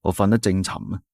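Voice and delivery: ordinary delivery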